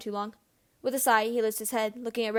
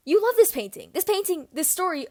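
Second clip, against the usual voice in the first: impassioned plea; 8 semitones higher, 5 dB louder than usual. Acted. James pleading with Regulus.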